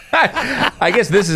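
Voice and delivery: slow words through a laugh. Real, not acted. Laugh while speaking.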